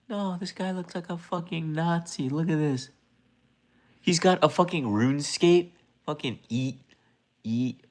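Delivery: a high, mocking put-on voice; high put-on voice jeering at a viewer.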